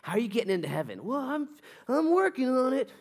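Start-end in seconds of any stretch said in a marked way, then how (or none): 1.0-2.9 high, put-on voice of a hesitant believer; sheepish believer. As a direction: play the unsure guy dodging. Acted reply of unsure believer.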